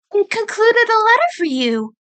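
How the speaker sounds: put-on high young female rabbit voice, earnest; turning serious as the sister. Sister turns serious with Nick, high earnest voice. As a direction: get down to business.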